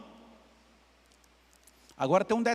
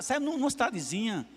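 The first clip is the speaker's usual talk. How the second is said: ordinary talk, nothing marked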